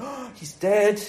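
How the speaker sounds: gasped, drawn-out dismay; mock shock. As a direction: react as a startled onlooker, lightly hammed-up. Onlookers acted shocked at the boy.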